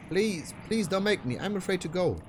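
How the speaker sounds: put-on high, frightened girl begging; pleading girl voice. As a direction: beg him not to send you back. Ruby begging.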